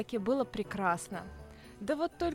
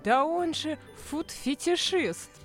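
mock indignation; 6 semitones higher, 6 dB louder, slower than usual. Heroine's exclamation voiced in tale.

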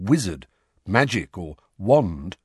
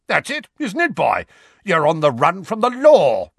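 uncle's gloating, much higher and louder; triumphant accusation; 9 semitones higher, 7 dB louder than usual. Acted. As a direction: pounce on him, sure you've caught him. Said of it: "with the triumphant air".

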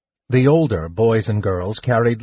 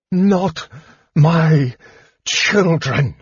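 slow, high, loud fury; enraged mother, word by word; rage; 6 semitones higher, 4 dB louder, much slower than usual. Acted. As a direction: force out each word with rage. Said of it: "she said with rage".

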